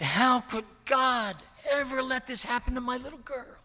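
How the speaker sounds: high, loud, anguished imitation of a weeping mother; retold sobbing plea. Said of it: "And she sobbed some more".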